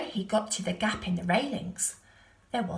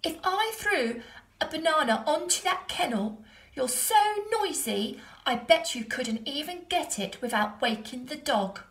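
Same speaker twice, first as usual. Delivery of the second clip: put-on cheeky boy taunt; 7 semitones higher, 3 dB louder than usual. A narrator's reading. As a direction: draw out the insult playfully. Stephen taunting the monkey.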